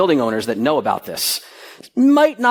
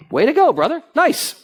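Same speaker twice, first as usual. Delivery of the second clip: cheering; 11 semitones higher than usual. Real, not acted. Speaker praising the hotel man's result, high and cheering. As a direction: cheer him on.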